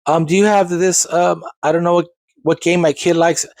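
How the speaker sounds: confused parent. Retold or imitated. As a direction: mimic a lost parent groping for a game's name. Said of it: "I always had parents stopping me saying".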